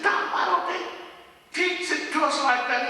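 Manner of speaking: high-pitched, aggrieved wonder; amazed student complaint. Retold or imitated.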